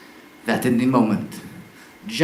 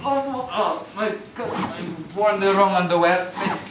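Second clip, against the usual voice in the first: hammed-up yelps of discomfort, higher; a clown wincing in an awkward harness; mock pain; 7 semitones higher, slower than usual. Performer in pain on straps.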